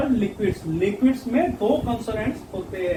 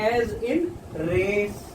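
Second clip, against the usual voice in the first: very slow, words drawn out; slow demo; 4 dB quieter, much slower than usual. Shown as a demonstration. Teacher modelling the re sound for students, very slow. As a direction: stretch the example word for the class. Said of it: "रे".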